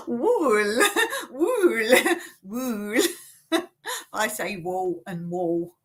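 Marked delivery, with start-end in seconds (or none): 0.1-3.0 "wool" with a long OO, giggling; exaggerated wool. Shown as a demonstration. Laughing at the unfamiliar word, drawn-out OO in "wool". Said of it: "it's supposed to be wall and wool". 4.6-5.7 "wall" twice with the same AW; both words alike. Shown as a demonstration. Telling viewers own accent merges them, identical "wall" vowels. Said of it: "I say them the same".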